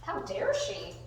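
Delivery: high, mock-outraged dog voice; indignant dog. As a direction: voice the dog's scandalized complaint. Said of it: "He's like".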